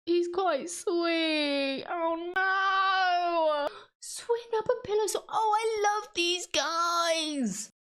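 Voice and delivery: high, slow gushing coo; cooing over something cute. Real, not acted. Gushing at her own cute drawing, high cooing. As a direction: melt over how cute it is.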